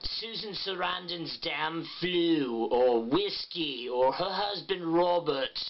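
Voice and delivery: slow, exaggerated vowels with a tight jaw; Pat Quinn impression. Shown as a demonstration. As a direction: drawl her topics like Pat Quinn. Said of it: "practicing with topics that she generally talks about".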